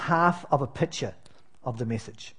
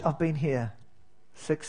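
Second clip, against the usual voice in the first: ordinary talk, nothing marked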